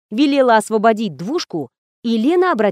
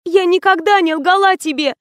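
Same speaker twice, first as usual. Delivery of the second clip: high, distraught denial; acted tearful outcry, pitched up; sobbing protest; 8 semitones higher, 3 dB louder than usual. A narrator's reading. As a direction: protest as if breaking into sobs. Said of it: "зарыдала Лена".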